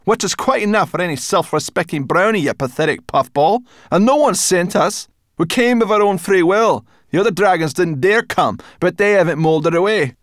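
high, loud angry retort; snapping brownie. A narrator's reading. Sorrel snaps at the strange brownie, high and loud. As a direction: snap back at him.

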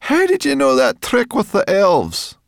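very high, loud question; loud calling. A narrator's reading. Sorrel asks Twigleg about his trick, loud high call.

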